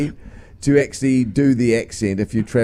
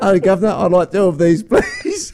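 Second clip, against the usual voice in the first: laughing mock-Cockney customer, pitched up; mock English accent; 5 semitones higher than usual. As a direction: imitate her hammed-up English accent, higher. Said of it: "that's what she does".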